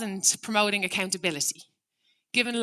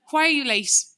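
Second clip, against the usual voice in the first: higher, louder blaming question; accusing demand; 6 semitones higher, 7 dB louder than usual. Shown as a demonstration. Parent confronting a late child, accusing. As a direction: throw the accusation at them.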